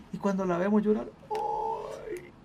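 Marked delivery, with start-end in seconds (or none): 1.3-2.3 drawn-out high wail of sympathy, acted; mock wail. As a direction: let out a thin, high mock-crying "ay". Acts out welling up on seeing tears.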